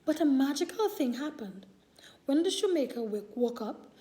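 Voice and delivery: ordinary delivery, nothing marked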